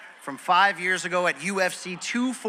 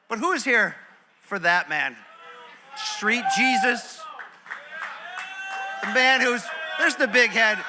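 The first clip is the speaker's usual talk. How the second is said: ring announcer; 3 semitones higher than usual. Acted. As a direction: stretch the fighter's name for the arena. Introducing second fighter to crowd.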